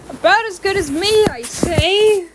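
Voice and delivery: high, brash and confident; cocky boast. A narrator's reading.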